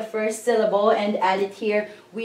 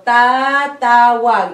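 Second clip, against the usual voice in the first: slow and louder tatawag for students; slow, loud teacher's demo of tatawag; slow emphatic demo; 7 dB louder, slower than usual. Shown as a demonstration. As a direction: lean on tatawag so the class hears it. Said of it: "remove the I N".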